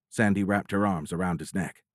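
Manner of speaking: plain reading voice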